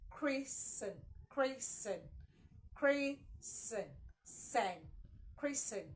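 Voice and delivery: christen broken into syllables, no T; silent T in christen. Shown as a demonstration. Teacher drilling the last option, christen with silent T. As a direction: drill christen, stretching out the sen. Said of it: "not christening of child".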